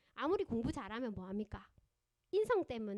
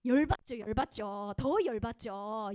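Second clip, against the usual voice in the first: fuming; 8 dB louder, slower than usual. Shown as a demonstration. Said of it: "그럼 어떻게 돼요?".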